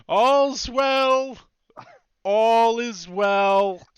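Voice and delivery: high, loud sing-out of a watchman; drawn-out shout to distant comrades, slowing; calling out. Acted. Charmed guard reports back to his comrades, calling out loudly.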